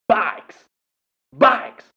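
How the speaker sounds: two explosive high shouts; imitated prisoner yell. Recalling the comedian's prisoner bit, high mimicked shouting. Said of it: "I think of".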